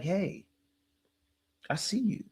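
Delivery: a quiet, gentle imitation; soft mock comfort. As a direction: hush it like a caring big man. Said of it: "this man is like".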